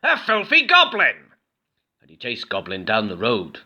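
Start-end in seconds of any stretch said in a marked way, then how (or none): 0.0-1.3 high, loud disgusted cry; disgusted shriek. A narrator's reading. Farmer yelling at Goblin, high loud disgust. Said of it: "shrieked the farmer".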